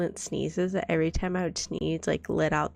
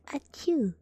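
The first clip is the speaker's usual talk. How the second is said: mock sneeze; 7 semitones higher than usual. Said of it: "I'm like".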